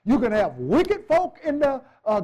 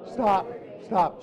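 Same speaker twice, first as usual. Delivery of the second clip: ordinary talk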